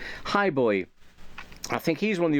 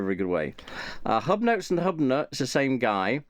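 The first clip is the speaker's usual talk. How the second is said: ordinary talk, nothing marked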